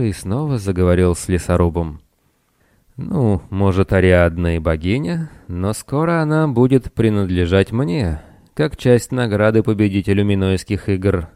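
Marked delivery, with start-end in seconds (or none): none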